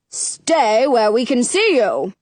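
slow, firm fatherly order; king's order. A narrator's reading. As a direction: order it slowly, as a king to his child. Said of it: "the king orders".

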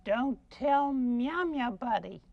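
slow, meowing cat-puppet voice; pussycat puppet. Acted. Cat asking a friend to keep a secret, slow meowing voice. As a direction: draw out the words like a talking cat.

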